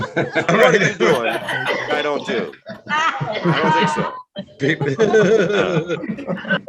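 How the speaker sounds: slow, drawn-out teasing; high incredulous drawl; playfully calling out a liar; mock disbelief. Real, not acted. Mocking disbelief.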